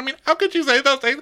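very high put-on wail; hammed-up high-pitched upset; mock hysterical. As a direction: ask in squeaky mock distress. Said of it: "start getting hysterical".

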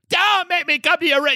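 mock street voice.

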